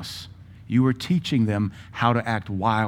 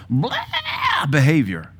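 mocking "blah"; 4 semitones higher, much slower than usual. Real, not acted. Mocking wild behaviour, drawn out.

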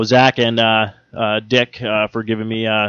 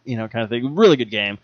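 ordinary talk, nothing marked

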